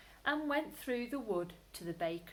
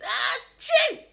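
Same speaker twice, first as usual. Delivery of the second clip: high loud sneeze; 14 dB louder than usual. Acted. Hiding rabbit giving himself away with a loud sneeze. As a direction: burst out a sneeze. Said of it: "Peter sneezed".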